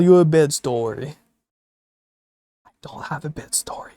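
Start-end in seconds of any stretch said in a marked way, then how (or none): 2.7-3.9 soft unvoiced whispered confession. Real, not acted. Streamer admits to chat in a whisper, no bedtime story. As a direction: whisper it like a secret. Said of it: "unvoiced whisper".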